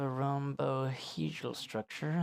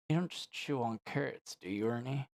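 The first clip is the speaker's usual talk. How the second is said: usual speaking voice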